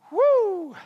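loud, high-pitched "woo"; frenzied whoop. Acted. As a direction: whoop it out like someone spinning wildly. Acting out his scattered frenzy.

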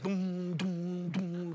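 low, soft mock thumping of music; older son hearing music from afar, imitated thumps; imitated bass thump. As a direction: mimic the distant beat.

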